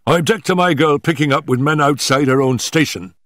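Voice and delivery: voiced father's angry protest; indignant father. A narrator's reading. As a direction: object crossly, as an affronted father. Angry father quarrels with daughter.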